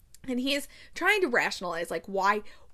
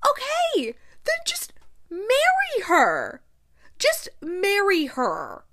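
much higher, louder and slow insistence; exasperated plea; 9 semitones higher, 5 dB louder, slower than usual. Real, not acted. Vlogger begging Benedict to marry her, exasperated. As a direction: beg him outright. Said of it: "exasperated plea".

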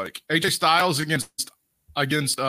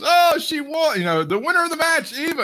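much higher, louder ring announcer call; mock ring announcer; 14 semitones higher, 3 dB louder than usual. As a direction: belt out the winner like a ring announcer. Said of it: "her ring announcer gets in there and goes".

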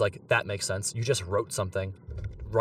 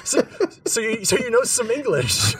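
laughing through the words in disbelief; real laughter breaking up the line, higher; 11 semitones higher, 5 dB louder than usual. Real, not acted. Laughing in disbelief at her English.

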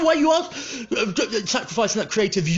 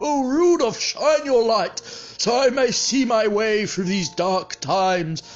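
hearty put-on Santa, higher, with ho-ho laughs; Articulate Santa voice; 4 semitones higher than usual. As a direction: play a jolly Father Christmas greeting his listeners. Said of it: "It's Articulate Santa".

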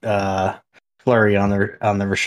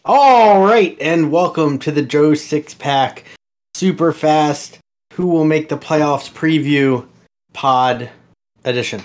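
big high opening, then slow announcing; podcast host intro; 4 semitones higher, 4 dB louder, slower than usual. Acted. Host opening the playoff preview pod, slow announcer style. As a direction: announce the show title slowly and grandly.

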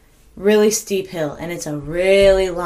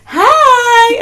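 put-on high, loud little-girl greeting; toddler's happy hi; 10 dB louder than usual. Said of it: "she's like".

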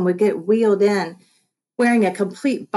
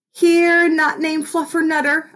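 excited call to a dog; 9 semitones higher than usual. Pretend. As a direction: call your dream dog over with glee. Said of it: "I said in my head".

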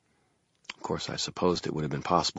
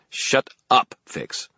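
emphatic higher, louder woman's rebuke; snapped; 9 semitones higher, 5 dB louder, much slower than usual. A narrator's reading. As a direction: snap each word, then drop on the name. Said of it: "snapped the female voice".

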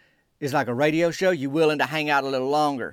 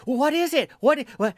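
higher, pleading voice of a nervous friend; anxious listener; 10 semitones higher than usual. Acted. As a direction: beg for the news, pitched way up. Acted bad-news dialogue, anxious listener.

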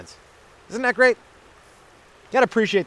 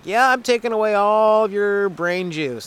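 mock snail; 6 semitones higher, slower than usual. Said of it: "it's just like".

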